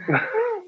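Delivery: a sudden high laugh at his own joke; high burst of laughter. Real, not acted.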